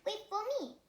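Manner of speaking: high small-fish voice calling; little fish calling. Acted. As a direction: call after him, high. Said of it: "he called".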